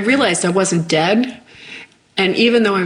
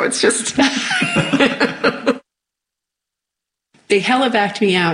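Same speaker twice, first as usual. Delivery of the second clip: usual speaking voice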